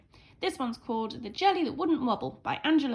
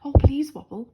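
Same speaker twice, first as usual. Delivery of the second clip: begging; 3 semitones higher, 10 dB louder than usual. A narrator's reading. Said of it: "begged the cook".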